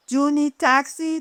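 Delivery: put-on high-pitched French-accented question; heavy French accent. A narrator's reading. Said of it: "with a heavy French accent".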